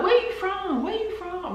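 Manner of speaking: high-pitched eager imitation of a curious local; imitating curious Americans asking about her accent, high and eager. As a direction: mimic the locals asking where she's from. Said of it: "they'll be like".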